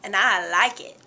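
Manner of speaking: slow, smug voicing of a fat creature, squeaking up on fat; lazy fat Pokemon voice. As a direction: lazily boast you can't be moved. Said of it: "he's like".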